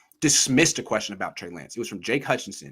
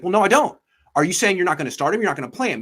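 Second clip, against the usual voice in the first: higher, louder mock pushback; challenging; 5 semitones higher, 5 dB louder, faster than usual. Reporter demanding the coach clarify, challenging. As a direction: imitate a reporter pressing for an answer.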